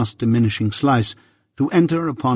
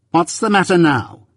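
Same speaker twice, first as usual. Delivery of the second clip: higher-pitched, snappy demand; smart sharpness; 7 semitones higher than usual. A narrator's reading. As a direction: ask it smartly, pitch raised then falling. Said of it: "said she smartly".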